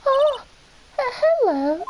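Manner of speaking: high, loud, startled British voice; British accent. Acted. Flustered girl greets a woman she knows, high British accent. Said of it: "why she became British there".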